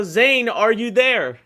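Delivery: ordinary talk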